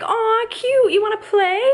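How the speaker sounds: very high, loud gushing; hammed-up sugary pet talk; mock cooing. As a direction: coo at a dog like a doting owner. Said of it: "When you don't have a baby".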